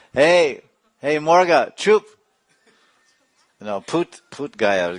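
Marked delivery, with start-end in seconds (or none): 0.1-2.0 scolding shout. Retold or imitated. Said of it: "So she said".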